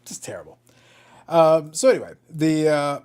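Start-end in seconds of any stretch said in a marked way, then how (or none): 0.0-0.6 whispered under the breath. Real, not acted. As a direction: let it fade to a whisper. Repeated comment fading out.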